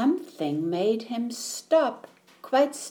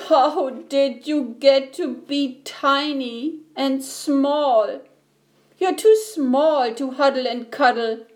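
louder, raised bawling voice; storybook bawling; 5 semitones higher, 4 dB louder than usual. A narrator's reading. Giant bear to a tiny teddy, bawling. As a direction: bawl it out, mildly hammed-up for a story. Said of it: "hear the voice bawl".

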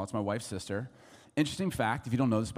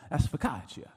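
Italian waiter; 3 semitones higher than usual. Retold or imitated. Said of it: "gentleman who is serving us is a local".

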